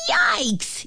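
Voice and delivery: high, narrated yelp of alarm; startled yell. A narrator's reading. Aunt startled mid-knitting by the ball, high mild yell. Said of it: "yelled Aunt Pearl".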